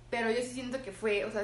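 ordinary talk, nothing marked

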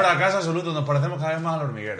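mock outrage. Real, not acted. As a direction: blow up over the flop, half joking. Failed demo, mock outrage. (indignación en broma, mucho más alto)

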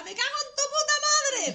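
full yelled curse, very high and loud; shouted curse. Shown as a demonstration. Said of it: "abre la ventana y grita".

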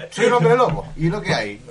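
mock defiant werewolf. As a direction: say it as if it is no big deal. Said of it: "voicing the killer's excuse".

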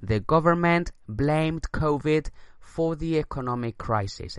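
slow English repeat; slow dictation read. Shown as a demonstration. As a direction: say it again slowly for the class. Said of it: "Repito la frase".

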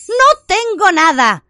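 high, loud, slow yell; shouting. Acted. Harry showing Bellatrix his empty hand, shouting. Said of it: "gritó Harry".

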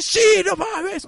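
high, drawn-out excited cry; thrilled inner man. Acted. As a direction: play a little man bursting with excitement. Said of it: "ese güey sí se emociona".

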